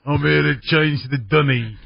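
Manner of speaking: mock Coronation Street British accent; a slow bad British [name]. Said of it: "In a British accent".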